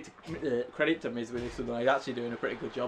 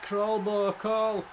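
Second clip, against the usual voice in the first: urgent cheering; 8 semitones higher, 3 dB louder, much slower than usual. Real, not acted. Urging wrestler in video game.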